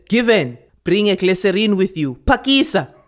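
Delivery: loud, high bossy call across the house; shouting. Acted. Grandmother shouting for the girl to hurry. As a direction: call her over sharply, impatient. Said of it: "Nkgonopeni shouted".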